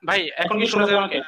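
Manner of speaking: loud voice in an echoing room; booming, echo-filled mic; heavy echo. Real, not acted. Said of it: "কিন্তু প্রচন্ড ইকো হচ্ছে".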